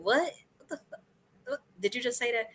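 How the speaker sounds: high startled what, then a stunned hush; shocked disbelief. Pretend. A listener, hearing the Buddha, mock shock. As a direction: gasp out disbelief at what was said.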